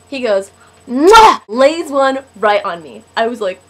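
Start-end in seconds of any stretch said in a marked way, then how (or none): 0.9-1.4 loud, high "mwah" smooch; hammed-up loud kiss sound; exaggerated kiss noise. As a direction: act out a big sudden smacking kiss. Imitates his big kiss.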